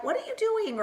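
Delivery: high-pitched imitation of a baffled doubter; mock confusion. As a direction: squeal it as a puzzled onlooker. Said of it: "people who are just kinda confused".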